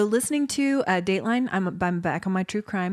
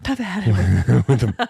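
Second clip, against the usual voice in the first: mocking repeat; 7 semitones lower, 8 dB louder, much slower than usual.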